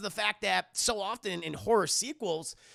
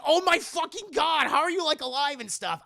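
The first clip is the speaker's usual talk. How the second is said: high, loud imitation of horrified surprise; hammed-up horror heroine; mock shock; 10 semitones higher, 8 dB louder than usual. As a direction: exclaim it in exaggerated disbelief. Retold shocked reply to killer.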